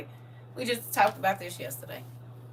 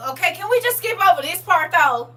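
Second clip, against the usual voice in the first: pleading to skip; 8 semitones higher, 8 dB louder than usual. Retold or imitated. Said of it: "I be like this".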